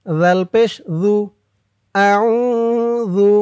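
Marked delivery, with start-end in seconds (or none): 1.9-3.3 slow, drawn-out melodic recitation; Quranic recitation. Shown as a demonstration. Teacher reciting the dua for viewers, slow drawn-out chant. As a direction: stretch every vowel in a measured chant. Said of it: "আর আমি যেখানে যেমন টান দিব".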